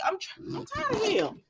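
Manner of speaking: character fed up with two brothers; exasperated complaint. Retold or imitated. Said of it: "she said".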